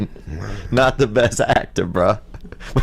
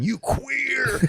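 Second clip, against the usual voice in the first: imitated heckle yell; 6 dB quieter than usual.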